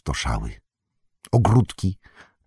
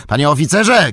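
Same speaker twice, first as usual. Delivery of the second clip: high, loud summons; sharp raised call; shouting; 9 semitones higher, 6 dB louder than usual. A narrator's reading. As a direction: shout for the officer's attention. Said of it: "krzyknął Merry".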